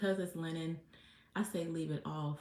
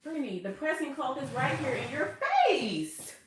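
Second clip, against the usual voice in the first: higher and louder, mock telling-off; exasperated at her own forgetfulness; self-scolding; 8 semitones higher, 10 dB louder than usual. Real, not acted. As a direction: scold yourself like a friend would. Scolding herself for forgetting the cloth.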